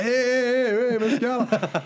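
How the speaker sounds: startled protest. Retold or imitated. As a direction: yelp in shock at the grab. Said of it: "og jeg bare".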